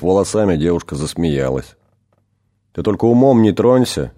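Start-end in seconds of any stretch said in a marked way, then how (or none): 2.7-4.1 louder, higher in alarm; startled warning, voice jumping up; frightened outburst. Acted. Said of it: "испугался демон".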